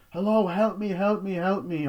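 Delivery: high pleading cry for help; calling for help. Retold or imitated. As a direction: beg someone upstairs to hear you. Trapped girl calling for help.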